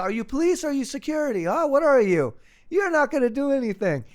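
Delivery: high-pitched, taunting challenge to the police; mock heckling. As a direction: imitate a cocky guy needling the cops. Acts out Austrian heckling the police.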